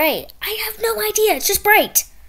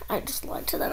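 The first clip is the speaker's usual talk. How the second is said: whisper; 4 semitones lower, 9 dB quieter than usual. Acted. Kid confides aside.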